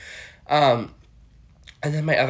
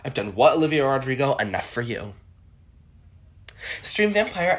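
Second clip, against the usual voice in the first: ordinary talk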